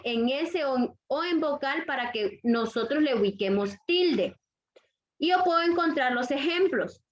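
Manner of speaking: usual speaking voice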